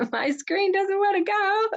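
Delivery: high mock-crying whine about the screen; playful fake cry at stuck tech. Screen share failing, laughing.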